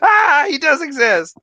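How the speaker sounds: high, loud mock shock; hammed-up astonished yelp; M&M's commercial impression. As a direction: cry out like a startled advert character. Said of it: "M&M's Christmas commercial".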